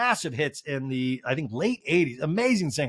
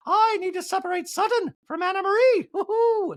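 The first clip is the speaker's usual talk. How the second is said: very high, hammed-up falsetto impersonation; Dorit; 3 dB louder than usual. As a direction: trill it out and end in a whoop. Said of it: "And Dorit's like".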